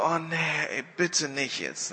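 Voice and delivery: drawn-out, dreading plea; reluctant groan. Retold or imitated. Wimber inwardly dreading the charismatic woman, reluctant. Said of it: "denkt schon so".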